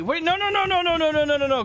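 high, rapid panicked protest; scrambling away in a real panic. Real, not acted. Gamer caught by a player, panicked high protest.

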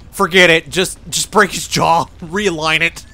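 loud, firm doctor's order; loud retold order. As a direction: bark it like a fed-up doctor. Quoting orthodontist, loud.

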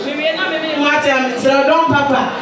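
ordinary talk, nothing marked

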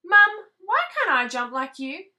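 high little tadpole voice. Acted. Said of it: "asked the tadpole".

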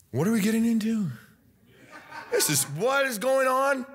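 high, mock-incredulous questioning; imitated bewilderment. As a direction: draw out the last question in acted disbelief. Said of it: "sitting there going".

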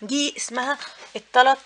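ordinary talk, nothing marked

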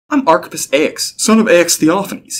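high, proud protest; indignant. A narrator's reading. Entrant defends his standing to the clerk, indignant. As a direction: announce your lineage with offence, slowing down. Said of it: "he said indignantly".

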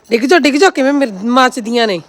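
high, loud yell; loud shout. Real, not acted. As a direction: shout it out sharply. Shouting at animals.

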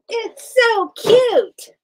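very high, loud, drawn-out delight; gushing squeal. Real, not acted. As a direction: squeal slowly over something adorable. Gushing over the thing she builds.

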